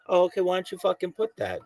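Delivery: mock exasperation. Mock argument with host.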